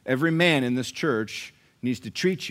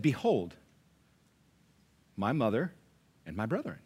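ordinary talk, nothing marked

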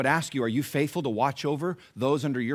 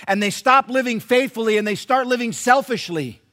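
raised, emphatic sermon voice; loud, higher-pitched preacher's exhortation; full-force preaching; 8 semitones higher, 10 dB louder than usual. Real, not acted. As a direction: drive the warning home to the congregation.

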